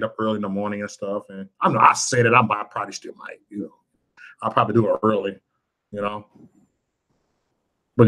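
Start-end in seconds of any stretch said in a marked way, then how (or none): none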